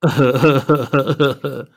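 slow wheezy stoner giggle; badly imitated stoner cackle; stoner laugh. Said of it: "It's just a fun laugh to imitate badly".

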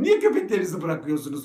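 mock casual scolding. Asking the owner why he lets his dogs loose, mock casual. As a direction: scolds as if it were small talk. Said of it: "Gayet doğal sohbet ediyorum".

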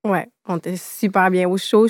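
ordinary delivery

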